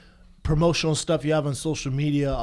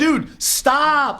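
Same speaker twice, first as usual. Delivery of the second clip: exasperated; 11 semitones higher than usual. Retold or imitated. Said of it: "I'm like".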